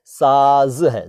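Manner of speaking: normal talking voice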